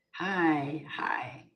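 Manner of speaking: soft, drawn-out whisper; whispered greeting. Real, not acted. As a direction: whisper hello to a viewer. Softly greeting a viewer in chat.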